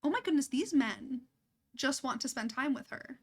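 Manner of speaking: normal talking voice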